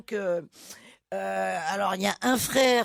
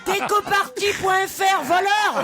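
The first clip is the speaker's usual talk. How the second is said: indignant shout; 7 semitones higher than usual. Real, not acted. Calls the company a thief.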